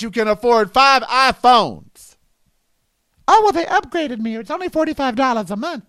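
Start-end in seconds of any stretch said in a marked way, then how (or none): 3.3-5.8 high, breezy mock voice; hammed-up excuse with a higher pitch. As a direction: play the careless spender, airy and quick. Mocking spendthrift parents' excuse.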